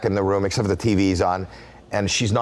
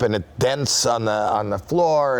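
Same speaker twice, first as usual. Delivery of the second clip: ordinary delivery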